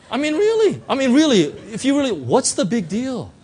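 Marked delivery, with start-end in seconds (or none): none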